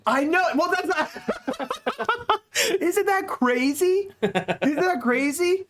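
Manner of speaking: high, drawn-out amazed squeal; can't believe it, laughing; high laughing disbelief; incredulous laughing disbelief. Real, not acted. Shocked at American ranking first.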